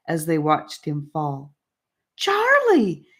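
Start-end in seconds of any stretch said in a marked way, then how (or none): 2.2-3.1 high, loud cry of alarm; alarmed exclamation. A narrator's reading. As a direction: call out as he falls. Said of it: "they exclaimed in unison".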